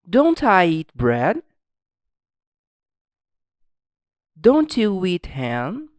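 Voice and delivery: slow, loud, carefully spaced questions with rising ends; language drill model. Shown as a demonstration. Teacher reads for students to repeat, slow projected drill voice. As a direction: read each question out slowly for a class to echo.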